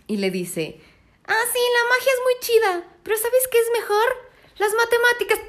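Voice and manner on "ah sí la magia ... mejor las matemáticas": high, mocking sing-song know-it-all; mocking the hero she dislikes, high and snide; mocking Spider-Man voice. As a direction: sneer the line like a smug teen. Mocking Spider-Man's line.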